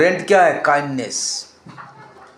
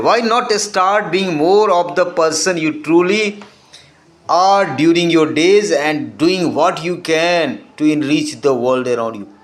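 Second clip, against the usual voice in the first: reading an English quote, very slow and deliberate; much slower than usual. Acted. Teacher reading a quote to students, slow and measured. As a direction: read each word out slowly to the class.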